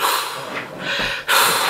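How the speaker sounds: long, soft, breathy sigh; exasperated sigh. Retold or imitated. Stuck riding home beside boyfriend, exasperated sigh. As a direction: act out a heavy huff of exasperation. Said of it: "I literally was just like".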